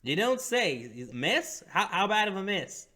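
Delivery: high put-on innkeeper voice.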